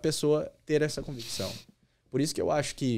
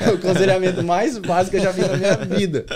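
laughing through the line, louder and higher; 6 semitones higher, 9 dB louder than usual. Real, not acted. Retelling the pastor's joke to the hosts, laughing out loud. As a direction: crack up while repeating the punchline.

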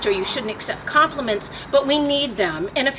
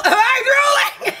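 mock worried question; 13 semitones higher, 5 dB louder, slower than usual. Asking people about drooling.

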